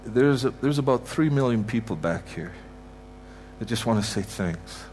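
ordinary talk

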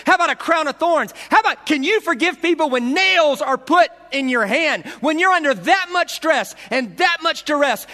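high, loud, building sermon cry; full-force preacher pushing the crowd; fiery preaching. Acted. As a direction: preach it hard, rising to a shout.

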